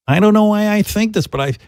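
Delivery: usual speaking voice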